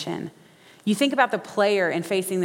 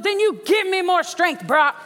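much higher and louder, demanding more; coach yelling; 11 semitones higher, 3 dB louder than usual. Acted. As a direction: shout him onward.

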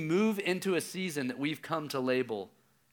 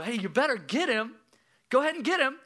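raised, higher urging; insistent calling out; 10 semitones higher, 5 dB louder than usual. Retold or imitated. Dad re-enacts urging lifeguard to save son, insistent. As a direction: call across the pool with growing insistence.

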